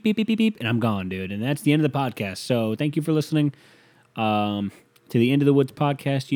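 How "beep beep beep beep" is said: high, even imitated beeps; machine beeping. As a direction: beep like a time machine firing up. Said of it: "they'll just go beep".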